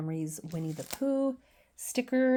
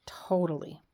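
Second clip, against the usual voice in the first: whisper. Real, not acted. Whispered agreement.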